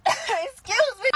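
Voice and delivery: slow, high, indignant; pointed excuse me. Acted. To her sleeping date, slow indignant excuse me. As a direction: drag it out, offended.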